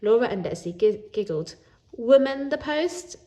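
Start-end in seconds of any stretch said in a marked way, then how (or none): 1.9-3.2 slow, high, louder mocking tone; teasing giggle. Girls mocking Jake's words, slow and high. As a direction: drag it out to mock. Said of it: "they said".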